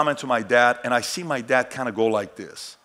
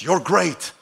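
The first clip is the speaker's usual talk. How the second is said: higher-pitched mock pep talk; typical affirmations; 6 semitones higher, 3 dB louder, slower than usual. Speaker imitating typical self-affirmations, in raised pep-talk delivery. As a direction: chant each affirmation as a short, lifted burst. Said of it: "it goes like this".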